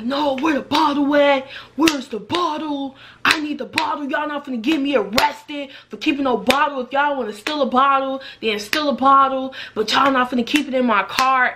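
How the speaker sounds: mock angry driver. Angry driver demanding the bottle.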